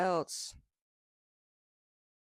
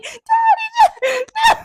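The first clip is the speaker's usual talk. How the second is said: high laughing shout, much louder; retold yell; 12 dB louder, much slower than usual. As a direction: scream the name through laughter. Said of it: "just yelling".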